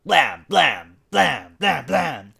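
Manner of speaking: loud, punchy blam blam like fists on a door; loud storybook knocking; knocking sounds. A narrator's reading. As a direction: bang out the knocks. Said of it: "Sheila knocked".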